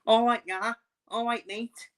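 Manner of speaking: high Scouse greeting; mock Liverpool accent. Streamer showing off his Scouse impression, high mock Liverpool accent. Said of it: "I can do the Stealth accent perfectly".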